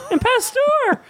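high, excited listing of names; calling out eagerly. Acted. Charles Wallace calling out names, high and excited. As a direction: call each name out like a kid. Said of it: "Charles Wallace called out".

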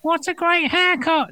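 very high squeaky little voice; high-pitched voice complimenting a haircut; tiny voice. Acted. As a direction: squeak it like a peanut. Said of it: "hears a voice that goes".

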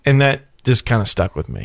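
ordinary talk, nothing marked

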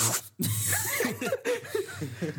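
squealing laugh; high-pitched laugh. Real, not acted. Friends laugh at a joke.

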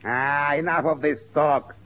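ordinary talk, nothing marked

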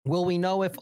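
usual speaking voice

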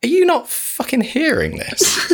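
high, incredulous disbelief; can't believe what he's hearing; exasperated outburst. Real, not acted. Ranting to friends about the film, exasperated disbelief.

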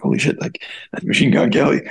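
hushed, barely voiced exclamation; retold starstruck hush. Telling of a celebrity walking into the bar, starstruck hush. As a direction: recount it in an amazed undertone.

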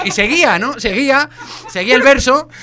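ordinary talk, nothing marked

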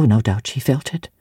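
plain reading voice

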